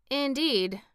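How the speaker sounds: ordinary delivery, nothing marked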